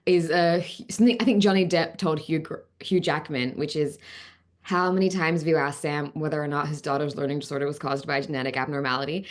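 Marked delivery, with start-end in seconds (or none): 4.6-9.3 low, even general American accent. Shown as a demonstration. Actor showing her warm-up sentence, general American accent. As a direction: practice line in general American, pitched down. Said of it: "getting to the American accent".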